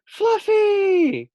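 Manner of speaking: very high, loud call of the name; cheering cry. Acted. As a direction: cheer for the hero. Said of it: "cried the guinea pigs".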